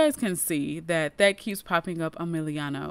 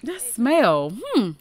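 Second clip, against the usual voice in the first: suspicious sniffing; 3 dB louder than usual. Acted. Javi sniffs suspicious smell.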